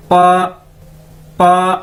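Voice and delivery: पा said loud and firm; loud ba drill; Chinese ba repeated. Shown as a demonstration. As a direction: repeat पा clearly. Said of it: "बी को कैसे pronounce करते हैं".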